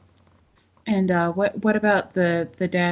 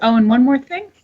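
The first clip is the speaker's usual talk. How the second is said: mock-casual afterthought; 6 semitones higher, 7 dB louder than usual. Character springs a final surprise.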